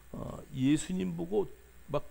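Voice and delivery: ordinary talk, nothing marked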